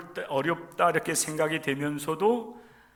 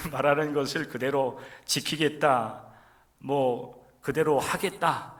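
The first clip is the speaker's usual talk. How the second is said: ordinary talk, nothing marked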